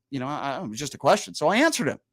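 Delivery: ordinary delivery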